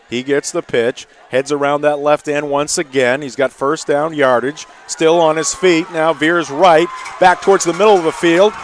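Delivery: building play-by-play on a long run; rising excited call. Real, not acted.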